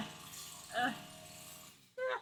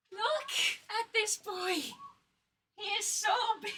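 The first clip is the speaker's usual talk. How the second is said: gushing; 6 semitones higher than usual. Real, not acted. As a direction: coo over the plush. Gushing over the plush.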